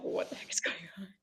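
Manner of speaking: put-on hushed, breathy whisper of puzzled girls. Daughters whispering about their crying mom, hushed whisper. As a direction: whisper it with a little laugh.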